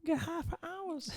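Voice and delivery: very high, drawn-out squeal; Tyrone Biggums. Retold or imitated. Host mimicking a crackhead character, very high put-on voice. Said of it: "Just think Tyrone Biggums".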